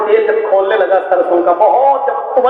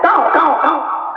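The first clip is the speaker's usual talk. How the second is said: crow cawing; 5 semitones higher than usual. Acted. Crow's cry in fable.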